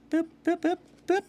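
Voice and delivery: very high squeaky boop sounds; high-pitched boops. Pretend. Playful sound effects.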